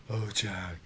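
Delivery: low, soft croon; special dog-talking voice. Shown as a demonstration. Uncle greeting his pit bull, low hushed croon. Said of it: "You have to talk to him like that".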